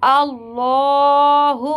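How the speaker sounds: अल्लाहु with a full, heavy lam, drawn out slowly in Quran recitation; tajweed demo of अल्लाहु, heavy lam, very slow. Shown as a demonstration. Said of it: "तो हम इसको मोटा पढ़ेंगे".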